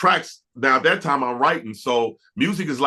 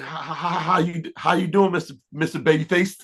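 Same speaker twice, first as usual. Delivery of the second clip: nervous, higher-pitched acted stutter; starstruck stammer; 5 semitones higher than usual. Young singer greeting his idol by phone, starstruck stammer. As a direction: stumble over the greeting, awed by the caller. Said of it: "my heart jumped up in my throat".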